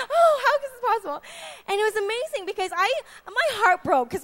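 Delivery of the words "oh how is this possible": mock disbelief. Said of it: "he's like".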